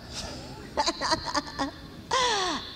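amused run of ha-has; hearty laugh. Real, not acted. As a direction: burst of laughter after telling the anecdote. Laughs at the retold apology.

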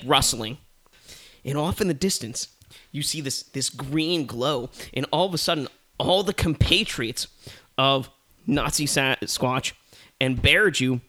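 usual speaking voice

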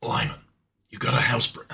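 quiet, gruff game-hero impression; softly growled comic reading; Solid Snake. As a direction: murmur it like a stealth soldier. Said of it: "in the voice of Solid Snake".

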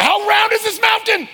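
very high, loud comic outburst; exasperated shout. Retold or imitated. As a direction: yell it in mock disbelief. Exasperated at endless corners.

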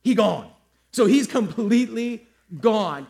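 loud punchline. Acted. Punchline about empty tomb.

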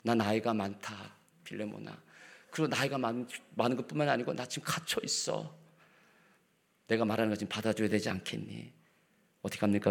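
soft, quiet pleading; gentle entreaty. Shown as a demonstration. Paul gently entreating Philemon, soft and loving. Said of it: "그냥 사랑으로서".

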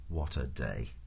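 low, soft resignation; weary lament. A narrator's reading. Prosser lies miserable in the mud, weary and low. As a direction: let it sink, low and soft.

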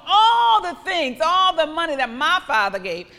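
praying aloud with a loud cry. Acted. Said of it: "I cry".

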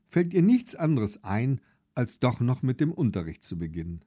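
ordinary delivery, nothing marked

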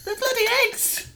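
high squeal of delight. Pretend. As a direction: shriek it out, thrilled. Squealing about giant eggs.

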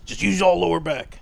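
mock bro coach. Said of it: "Did you teach him the old lower back stance".

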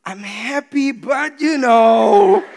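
slow, cracking with laughter; torn, laughing. Retold or imitated. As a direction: laugh through the conflict. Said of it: "I'm in conflict".

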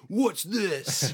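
high, drawn-out gotcha question; mock accusing. Border guard finding a lighter in the car, mock accusation. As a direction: imitate a guard pouncing on evidence.

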